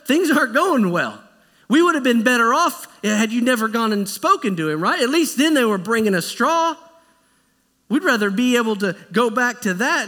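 the Israelites turning on Moses and Aaron, loud and aggrieved; complaining crowd. Acted. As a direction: blame him loudly, like a fed-up crowd. People blaming Moses and Aaron.

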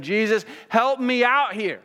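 high, loud, desperate plea; pleading Pilate. Retold or imitated. Preacher voicing Pilate begging Jesus, loud and pleading. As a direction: beg as a frightened governor would. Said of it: "He's saying".

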